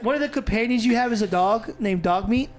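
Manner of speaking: ordinary talk, nothing marked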